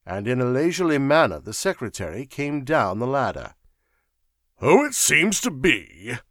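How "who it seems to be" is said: slow, higher astonished echo. A narrator's reading. Sir Walter repeating the secretary's words, astonished. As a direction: repeat it slowly in disbelief. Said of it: "repeated Sir Walter in astonishment".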